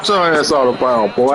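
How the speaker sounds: ordinary talk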